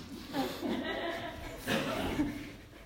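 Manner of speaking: breathy high burst of laughter; real laughter. Real, not acted. Pastor cracking up at his own story, laughing hard.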